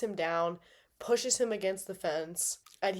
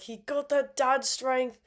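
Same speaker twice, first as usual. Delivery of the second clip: a lightly voiced, slow and higher John; bad John impression; 5 semitones higher, 3 dB louder, slower than usual. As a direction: imitate John lazily, a bit slower. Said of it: "That was a bad John impression".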